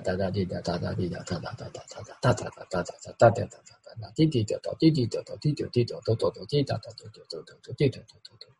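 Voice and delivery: rapid di-da syllable chatter; stammering foreign tongue. Shown as a demonstration. Preacher shows how foreigners' lips sound, rapid stammering syllables. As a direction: rattle out stuttered syllables like unknown speech. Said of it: "你听起来像但以理的".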